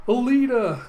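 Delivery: high, loud cry of alarm; shocked shout. Acted. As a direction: call out to her in acted shock. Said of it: "Ed and Umba just completely shocked".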